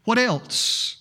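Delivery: preacher pondering aloud, hushed and searching; dramatic sermon opening. Acted. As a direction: muse aloud to the congregation, letting each question hang.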